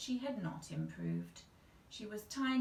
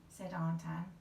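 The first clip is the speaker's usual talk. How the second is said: ordinary delivery, nothing marked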